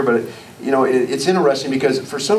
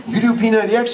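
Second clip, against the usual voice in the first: surprised; 9 semitones higher, faster than usual. Retold or imitated. Surprised quote to fishing guide.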